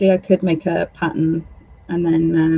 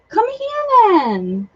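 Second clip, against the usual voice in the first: mock cat voice; 13 semitones higher, much slower than usual.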